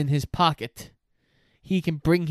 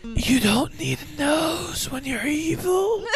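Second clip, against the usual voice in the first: high, shouted cartoon-evil voice; hammy villain; 12 semitones higher than usual. Cohost joking about noseless Voldemort, shouted villain voice. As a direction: ham it up like a pantomime baddie.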